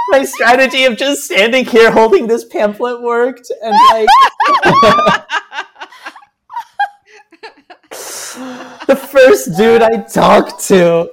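high-pitched, cracking up mid-sentence; helpless laughter. Real, not acted. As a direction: squeal it out through real laughter. Overcome with laughter at the memory.

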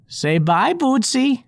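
light sweet granny; old woman voice. Acted. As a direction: coax the pet to wave.

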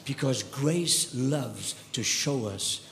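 low, soft, intimate sermon voice; quiet heartfelt preaching; hushed preaching. Acted. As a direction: draw the church in close, softly.

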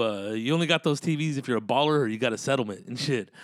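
usual speaking voice

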